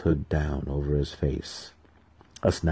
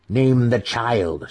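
slow, pleading, higher and louder; imploring plea; 6 semitones higher, 5 dB louder, much slower than usual. A narrator's reading. Priestess daughter urging her mother, slow imploring plea. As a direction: beg her mother slowly. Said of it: "she implored her mother".

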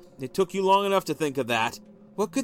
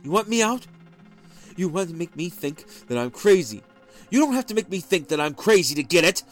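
angry rant; 4 semitones higher, 5 dB louder than usual. A narrator's reading. Husband ranting.

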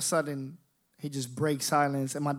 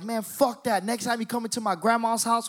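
retold angry threat; 7 semitones higher than usual. Cousin's retold threat on the phone.

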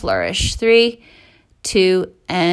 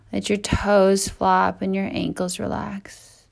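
soft spa whisper; 5 dB quieter than usual. Acted. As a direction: soothe them into stillness. Guided relaxation, quiet.

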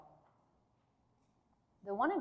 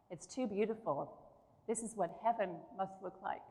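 choked up; 10 dB quieter than usual. Real, not acted. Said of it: "Sorry, I choked up".

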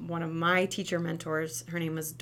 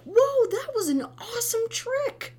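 put-on much higher, louder "whoa" for a toddler; playful cheer; 6 dB louder than usual. Shown as a demonstration. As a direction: cheer the fall like a great trick. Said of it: "Make it a joke".

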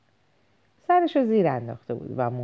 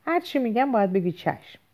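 sudden shout; 8 semitones higher than usual. A narrator's reading. Father to son at dinner, a read-aloud shout. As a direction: bark the order up high like an enraged father. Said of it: "یک باره فریاد کشید".

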